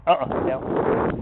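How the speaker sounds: low, slow, calm down cue. Real, not acted. Trainer calming a jumpy dog, slow low command. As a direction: bring your voice down to settle the dog. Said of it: "You notice I lowered my tone too".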